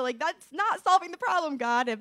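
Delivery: retold frustration, high-pitched; exasperated protest. Woman recalling her complaint to God, exasperated. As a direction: protest upward, as if arguing with God.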